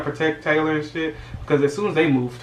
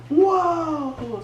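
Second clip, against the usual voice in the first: very high drawn-out whoa; awed whoa. Retold or imitated. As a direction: gasp in wonder. Awed exclamation.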